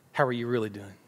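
low, soft and caring; gentle sincere question. Acted. What people want somebody to ask them, gently sincere. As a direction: softly invite an honest answer.

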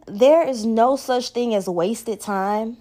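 normal talking voice

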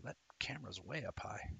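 unvoiced whisper. Real, not acted. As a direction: whisper it as an aside. Aside about the camera.

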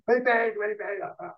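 high-pitched mock yell, repeated; retold screaming. Jets workers on a golf cart yelling at stranded guest, high mock yell. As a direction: shriek the question up high, half in jest. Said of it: "they start screaming".

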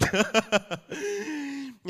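short high laugh. Real, not acted. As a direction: laugh at the joke. Laughs at own example.

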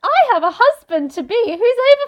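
high, loud, gushing mock delight; hammed-up willing maiden, pitched high; Virgin Mary. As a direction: put on a sarcastically eager young girl's voice. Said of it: "if you read the Bible, it's like".

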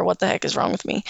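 usual speaking voice

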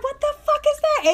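very high mock-shocked question; retold shock. Woman retelling her reaction to her friend, high-pitched shock. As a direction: replay the disbelief high in the voice.

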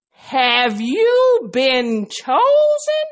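dramatic question. Acted.

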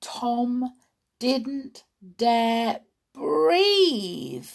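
ordinary delivery, nothing marked

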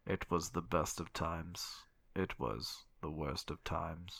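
low, slow and sinister reading; serial killer audiobook voice. Shown as a demonstration. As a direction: read Dickens like a creepy killer. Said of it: "I should read them like this".

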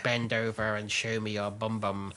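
low, slow, monotone creep; G-Spot man. Acted. G-man parody making a crude demand, low and slow. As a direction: drop low and stretch it out for a lewd parody. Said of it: "No, he's the G-Spot man".

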